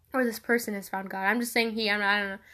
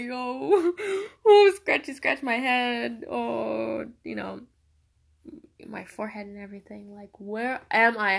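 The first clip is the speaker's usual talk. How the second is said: dazed prophet; 5 dB louder than usual. Retold or imitated. Voicing dazed prophet's inner thoughts.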